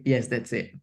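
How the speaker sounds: normal talking voice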